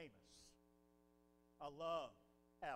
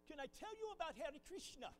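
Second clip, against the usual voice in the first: put-on Indian accent; 7 semitones higher than usual. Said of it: "with an Indian accent".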